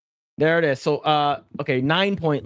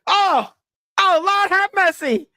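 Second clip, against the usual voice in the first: much higher, louder, laughing mock squeal; Chris Tucker impression; 13 semitones higher, 4 dB louder than usual. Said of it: "He's like, he's like".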